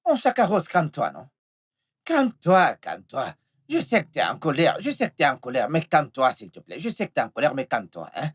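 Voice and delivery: low-pitched, soothing coaxing; placating plea. Acted. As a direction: gently beg an angry lover to calm down. Pleading with angry lover to calm down.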